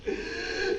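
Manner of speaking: high-pitched burst of laughter; acted laughter. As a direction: laugh out after the outcry. Laughs after shouting of revolt.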